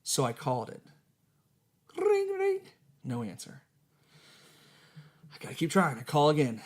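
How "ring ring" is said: high sing-song ring ring, much higher; phone ring imitation. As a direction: chirp it like a phone ringing.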